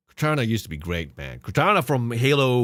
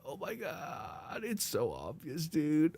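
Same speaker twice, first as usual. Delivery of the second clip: fading disbelief; 7 semitones higher, 10 dB quieter, slower than usual. Real, not acted. Disbelief fading after the outburst.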